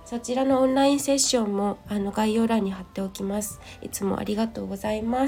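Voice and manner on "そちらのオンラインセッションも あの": usual speaking voice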